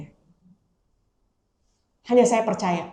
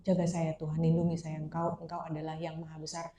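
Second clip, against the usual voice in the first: soft, slightly lowered praying voice; private prayer; 2 semitones lower, 9 dB quieter than usual. Acted. Praying alone in the bathroom when anxious, soft private prayer. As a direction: murmur an understated plea to God. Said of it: "saya masuk kamar mandi".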